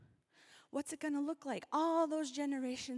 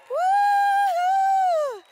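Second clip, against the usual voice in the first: cheering whoop; 19 dB louder than usual. Real, not acted. Cheering the crowd.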